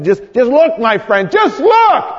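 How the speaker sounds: high, loud cry that leaps up at the end; urgent pleading. Acted. Friend urging dying Jimmy to look, urgent shout. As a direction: plead with rising desperation, voice shooting up.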